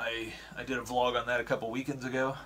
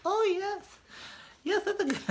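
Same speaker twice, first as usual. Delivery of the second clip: squeaky falsetto of delighted taste buds; very high, laughing cartoon squeal; taste buds' voice. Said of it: "Your taste buds are like".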